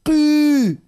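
قي said loud and high with a sharp i; exaggerated qi. Shown as a demonstration. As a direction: bark out قي to show the kasra. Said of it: "تلقى ماشي للكسر".

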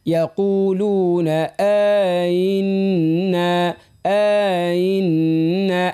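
very slow chanted آئنا, first آ held long; long first hamza. Shown as a demonstration. Teacher modelling for the girl, very slow chanted آئنا. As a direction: stretch the آ of آئنا before gliding on. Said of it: "الهمزة الأولى نمدوها".

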